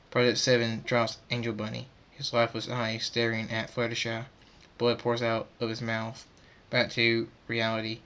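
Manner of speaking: ordinary delivery, nothing marked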